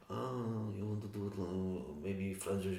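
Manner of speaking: a vague, slow mock accent for Brando; lightly done mock Brando accent; terrible accent. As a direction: put on a bad, unplaceable accent. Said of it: "don't know what that accent is".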